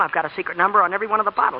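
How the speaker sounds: usual speaking voice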